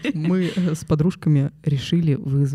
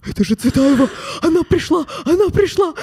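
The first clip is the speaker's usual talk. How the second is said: высокий громкий испуганный крик; кричать в панике; 11 semitones higher, 7 dB louder than usual. Retold or imitated. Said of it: "такие". (испуганный визг)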